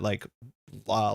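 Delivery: ordinary talk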